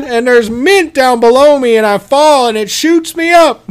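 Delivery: high, slow, loud, enraptured; dreamy high voice. Acted. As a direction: gush like you're floating. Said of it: "And he's like".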